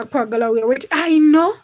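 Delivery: normal talking voice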